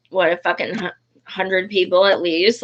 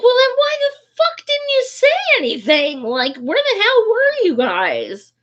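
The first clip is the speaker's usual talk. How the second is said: indignant outburst; 12 semitones higher than usual. Retold or imitated. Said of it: "And I'm like".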